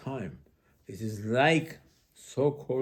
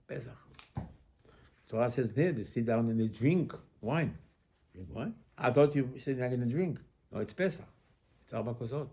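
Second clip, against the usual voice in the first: ordinary delivery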